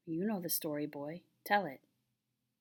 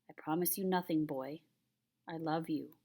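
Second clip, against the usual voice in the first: usual speaking voice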